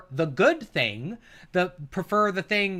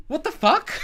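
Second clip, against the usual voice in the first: incredulous laughter; 11 semitones higher than usual. Real, not acted. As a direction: crack up in disbelief while saying it. Laughs in disbelief at argument.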